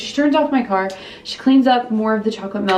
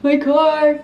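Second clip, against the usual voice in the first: higher-pitched imitated wail; mock sobbing; 5 semitones higher, 4 dB louder than usual. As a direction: imitate wailing into the phone. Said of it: "So I call Ben and I'm sobbing".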